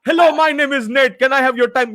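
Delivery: high mock telemarketer greeting; put-on call-centre voice; scam caller. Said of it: "they're fucking always saying that".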